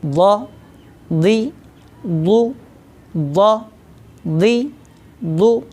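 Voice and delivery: व, दी, दु each said alone, slowly with pauses; practice drill syllables. Shown as a demonstration. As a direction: drill व दी दु with the heavy dhad from the tongue's side. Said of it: "इसके हम practice करते हैं".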